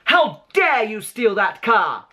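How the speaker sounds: high, slow, furious mother's voice; Mrs Weasley scolding. Retold or imitated. Mother scolding her son for stealing the car, shrill. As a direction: scold like an outraged mum. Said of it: "I also like to quote".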